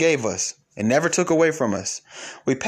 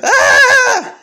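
very high, loud hammed-up shriek; exaggerated labor yell; mock labor shriek; 11 dB louder than usual. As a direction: shriek like the final push. Acting out woman in labor.

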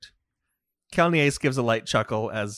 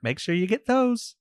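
lilting, higher-pitched light character voice; teasing reminder; 11 semitones higher than usual. Acted. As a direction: toss it out as a playful reminder. Game master voices Kelniace's teasing reminder.

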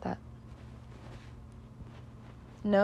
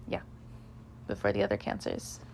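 whispered aside; 12 semitones lower, 8 dB quieter, faster than usual. Real, not acted. Whispered aside mid reading.

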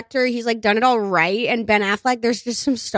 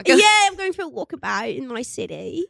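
higher, mock failed American accent; Nicole Kidman; 4 semitones higher than usual. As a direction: play an actress slipping out of her American accent. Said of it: "refuses to do an American accent".

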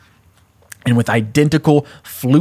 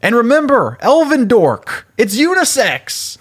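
James Potter; 12 semitones higher than usual. Acted. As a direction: call back teasingly to the cops, pitched up. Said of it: "James is like".

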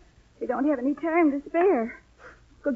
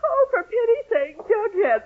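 high and teary, exasperated; a tearful sigh of exasperation; crying; 7 semitones higher than usual. Acted. Teary mother greets the boy at the door. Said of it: "are you crying".

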